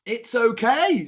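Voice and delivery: loud, high, drawn-out mock reassurance; hammed-up reading of a kind comment; Paul. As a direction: holler the comforting words slowly. Voicing Paul's comment aloud.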